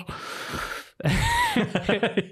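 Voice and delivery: high, open burst of laughter; big laugh. Real, not acted. Laughing at his own joke.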